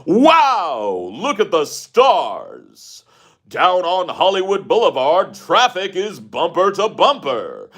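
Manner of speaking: put-on high, stretched-out old-time radio announcer; 1920s announcer voice. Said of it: "that weird nineteen twenties announcer voice".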